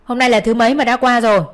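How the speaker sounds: shouted down. A narrator's reading. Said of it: "quát vọng xuống".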